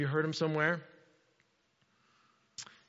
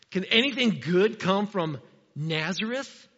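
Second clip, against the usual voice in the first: slow, scornful acted question; prejudiced doubt. Nathanael scorning Nazareth to Philip, prejudiced doubt. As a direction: draw out the doubt about the town. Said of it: "a very prejudiced comment".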